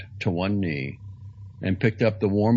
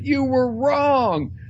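high, loud and slow cry; 6 dB louder, much slower than usual. Acted. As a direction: stretch the cry out as you fall. Falling from cliff, crying out.

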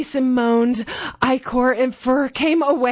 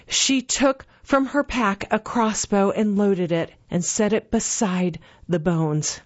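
ordinary delivery, nothing marked

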